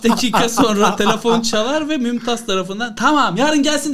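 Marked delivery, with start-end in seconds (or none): 3.0-3.9 doctor on the phone. Retold or imitated. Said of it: "dedi doktor".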